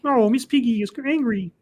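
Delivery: high-pitched puppet frog voice; high, wobbly frog impression; Kermit the Frog. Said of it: "Depends on what pitch I'm doing".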